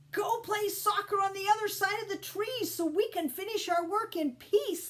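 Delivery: high, fed-up telling-off; shouted. A narrator's reading. Busy rabbits chasing Ralph off to finish work, annoyed shout. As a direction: shoo him away, exasperated. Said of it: "The rabbits shouted at Ralph".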